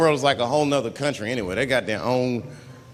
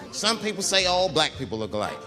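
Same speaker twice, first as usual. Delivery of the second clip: ordinary talk, nothing marked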